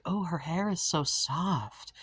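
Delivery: put-on a touch of a little girl cooing over her doll; daughter's voice. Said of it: "and she was like".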